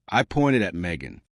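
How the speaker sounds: plain reading voice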